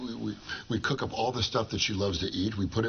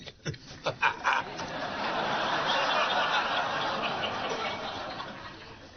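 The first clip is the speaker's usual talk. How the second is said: long breathy laugh; 5 semitones higher than usual. Real, not acted. Long laugh at own joke.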